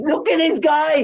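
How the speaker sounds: exasperated. Real, not acted. Fan fuming at the bowler, co-host tells him to calm down, exasperated. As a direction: jab at the player in disbelief. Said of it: "Calm down".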